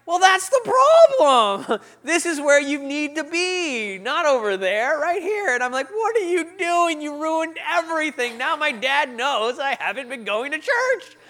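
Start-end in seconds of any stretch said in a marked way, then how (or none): 0.0-5.5 slow, high, drawn-out explaining; hammed-up helpful church greeter; overeager greeter. Greeter corrects him helpfully. 5.9-11.2 high, loud, drawn-out complaint; hammed-up dismay at being exposed; exasperated outburst. Retold outrage at greeter.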